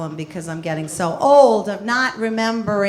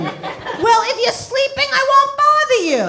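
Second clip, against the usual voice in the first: mother voice; 14 semitones higher, 3 dB louder than usual. Retold or imitated.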